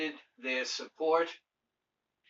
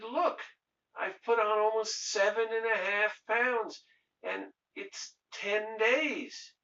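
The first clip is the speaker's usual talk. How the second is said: pitched up high, defensive and a bit exasperated; higher defensive woman's voice; 7 semitones higher than usual. Retold or imitated. Doctor voicing a client admitting ten days' gain, high and defensive. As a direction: play the guilty client, high and pleading.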